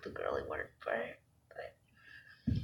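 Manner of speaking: low whispered aside. Real, not acted.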